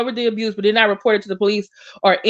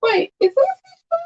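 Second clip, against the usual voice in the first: very high, upset pitch; upset, voice climbing far above normal; voice going high; 13 semitones higher than usual. Real, not acted. Looking up whether he has children, voice pitched very high. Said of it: "My voice is going high".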